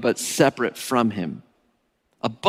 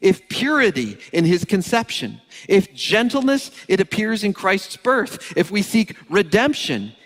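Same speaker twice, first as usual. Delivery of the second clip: higher, rising preacher's reading of a quote; fervent reading; 7 semitones higher than usual. Acted. Preacher reading Calvin to the congregation, fervent and higher. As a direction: read it like a proclamation, each line lifting.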